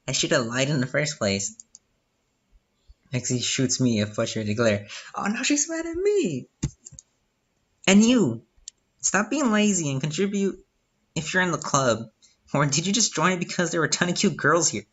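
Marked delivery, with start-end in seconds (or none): 5.1-14.8 put-on angry girl voice. Voicing angry club member Lexi.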